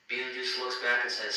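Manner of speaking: plain reading voice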